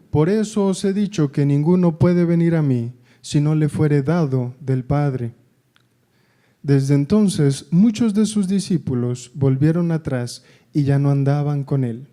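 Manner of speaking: loud, solemn reading of the Gospel text; scripture reading. Acted. Preacher reading John six aloud, loud and solemn. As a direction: proclaim each verse to the congregation. Reads the Bible passage aloud.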